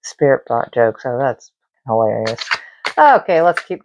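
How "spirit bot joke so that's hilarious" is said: dry sarcasm. Real, not acted. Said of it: "you're hilarious".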